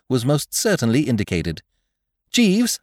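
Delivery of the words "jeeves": higher, brisk gentleman's call; rally the valet to action; urgent young master. A narrator's reading. Young master urgently summoning his valet, higher voice. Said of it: "I said".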